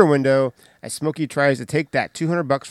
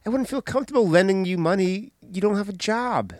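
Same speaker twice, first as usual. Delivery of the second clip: lightly voiced mom; 6 semitones higher than usual.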